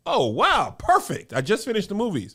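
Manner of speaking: delighted, pitch jumping up; thrilled at the shop; excited. Retold or imitated. Retelling his delight.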